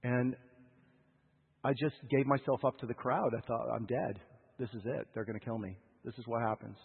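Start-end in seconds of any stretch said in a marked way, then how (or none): 3.8-6.8 soft, low resigned murmur of doom; quiet, lower, numbed with fear; hushed dread. Retold or imitated. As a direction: say it as if already given up. Terrified inner thought, frozen.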